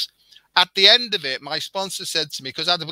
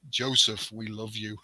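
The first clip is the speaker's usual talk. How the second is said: soft, hushed otherworldly voice, much softer; a gentle voice out of nowhere, barely there; mysterious voice; 6 semitones lower, 7 dB quieter than usual. Retold or imitated. A voice in his room calling him by name, hushed. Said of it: "this voice said to me".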